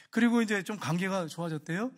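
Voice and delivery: ordinary talk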